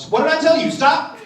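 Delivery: loud, high-pitched telling-off; mock scolding. Hotel clerk orders guest to stop talking, loud mock outrage. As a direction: imitate a clerk shouting at him to stop.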